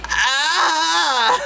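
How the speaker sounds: high shrieked "Ah!"; a campy falsetto scream; mock scream. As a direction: shriek it, hammed up. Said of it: "She screamed".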